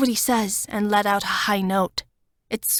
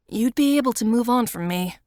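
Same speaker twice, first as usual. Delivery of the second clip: ordinary delivery, nothing marked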